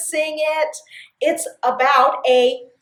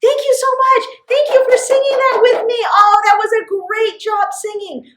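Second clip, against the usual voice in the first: bright praise; 9 semitones higher, 5 dB louder than usual. Real, not acted. Praises children after song.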